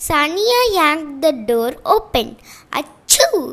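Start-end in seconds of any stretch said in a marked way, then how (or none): 2.8-3.4 loud high sneeze. Acted. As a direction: sneeze it out sharply.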